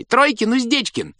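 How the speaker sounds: put-on children guessing from the nursery, high shouting. A narrator's reading. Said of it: "кричали из детской".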